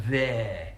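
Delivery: "there" with a long, widened "air"; Jermaine Jenas's accent. Retold or imitated. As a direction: stretch the vowel of "there", mouth wide. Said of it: "Okay, that's a bad impression".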